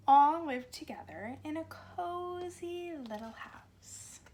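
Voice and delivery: put-on slow, lively storybook reading for little kids; reading to children. A narrator's reading. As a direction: read it like story time on the carpet. Reads picture book to children.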